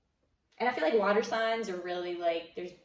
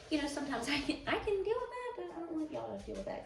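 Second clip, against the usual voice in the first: high squeaky aside; 8 semitones higher, 5 dB quieter, faster than usual. Real, not acted.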